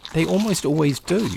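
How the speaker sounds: normal talking voice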